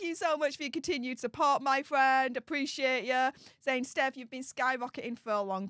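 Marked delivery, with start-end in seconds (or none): none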